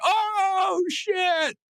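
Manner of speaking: a soaring, high crowd's oh shit; crowd gasp. Retold or imitated. Comic retelling of a wrestling move, crowd's stunned oh shit. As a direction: imitate a stunned crowd gasping. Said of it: "the crowd will be like".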